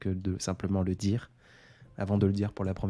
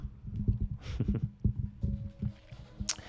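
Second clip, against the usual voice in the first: breathy, hushed giggle; soft whispered laugh; 6 dB quieter than usual. Real, not acted. Joking about staying late with the audience, hushed laugh. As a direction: let out a quiet laugh under the breath.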